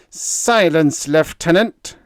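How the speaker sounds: loud, high, furious command. A narrator's reading. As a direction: cut him off in fury. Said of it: "wild with fury now".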